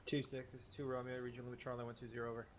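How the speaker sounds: over walkie talkie. Real, not acted. Radio call on game net.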